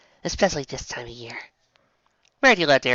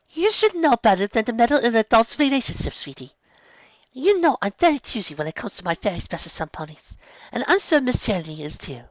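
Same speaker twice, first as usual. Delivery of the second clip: gentle scolding; 10 semitones higher than usual. A narrator's reading. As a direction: chide her kindly. Rarity gently scolds sister.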